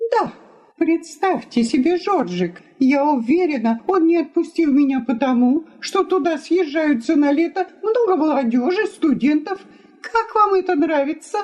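high, quick, complaining wife; indignant wife. Acted. Wife complains to guest about her husband, indignant.

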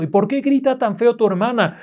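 high-pitched aunt calling to her nephew; acted calling out. Aunt next door questions the boy about screams, calling out. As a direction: raise the pitch, demand an answer. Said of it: "le está gritando".

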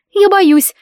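very high, tight frightened squeak; girl squeaking in fright in the dark; strangled squeak. Acted. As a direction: squeak it out in fear. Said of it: "сдавленно пискнула девушка".

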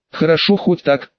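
ordinary delivery

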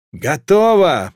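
slow, loud called-out word; shouted from below. A narrator's reading. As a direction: call it up from below. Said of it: "прокричал снизу рыжий Зюс".

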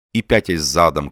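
plain reading voice

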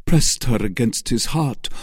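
usual speaking voice

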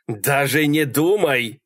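exasperated drawn-out order; shouted warning. A narrator's reading. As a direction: stretch out the threat. Malcolm warns her against hitchhiking.

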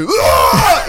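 imitated shout. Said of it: "il fait".

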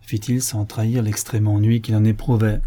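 plain reading voice

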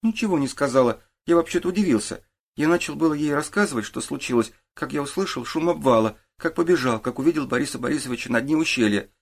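lightly voiced boy giving his account; boy witness. A narrator's reading. Boy tells the detective what he did, earnest.

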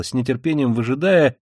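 plain reading voice

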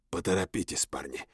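low, soft hoarse rasp; hoarse croak. A narrator's reading. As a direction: croak it out, beaten and bloody. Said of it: "прохрипел я".

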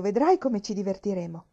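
usual speaking voice

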